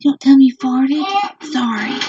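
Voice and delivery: storybook read-aloud voice to little ones. Real, not acted. Mother reading a farm book to her children, storybook voice.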